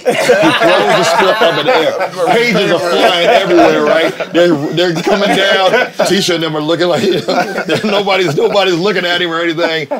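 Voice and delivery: can barely get the words out for laughing; laughing through it. Real, not acted. Telling the podcast hosts about the flying script, laughing.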